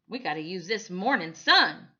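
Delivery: loud urgent order; barked order. A narrator's reading. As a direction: bark it, hurry them. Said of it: "he barked".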